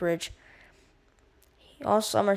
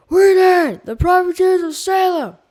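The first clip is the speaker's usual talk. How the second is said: loud, high cheering shout; roaring crowd; 11 semitones higher, 14 dB louder than usual. A narrator's reading. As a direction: roar it back as a crowd. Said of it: "The others roared the answers".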